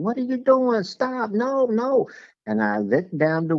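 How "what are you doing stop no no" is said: much higher mock yell at a runaway dog; panicked woman shouting at her dog, high mock yell; imitating a panicked owner. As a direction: play the frantic dog owner, pitched way up. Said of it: "What is she screaming?".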